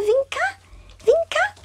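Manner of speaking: plain reading voice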